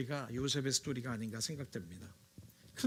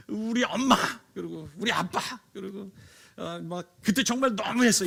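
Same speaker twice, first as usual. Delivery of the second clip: 서운함 섞인 하소연; 4 semitones higher, 5 dB louder than usual. Pretend. Imagined congregant recalling parents' favouritism.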